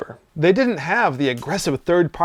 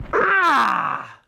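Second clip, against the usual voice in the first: short cry; 14 semitones higher than usual. Acted. Host cries out at the violence.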